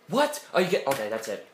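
ordinary talk

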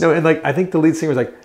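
ordinary delivery

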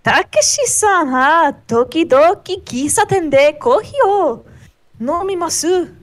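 put-on anime girl voice. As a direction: try the anime girl again. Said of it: "Let me try it again".

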